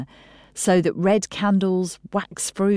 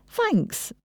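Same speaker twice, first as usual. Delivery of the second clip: very high-pitched, delighted little thanks; beaming thanks; 3 dB quieter than usual. Acted. Hannah thanking her niece for a compliment, beaming. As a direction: beam with pleasure at the compliment. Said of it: "Hannah beamed".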